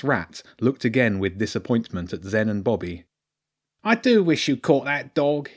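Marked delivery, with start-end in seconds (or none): 3.8-5.5 high-voiced disappointed rat lord; Lord Ratzenberg. Rat lord regrets the uncaught dog, high complaining voice. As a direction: lament it as a put-upon rat. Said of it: "he sighed".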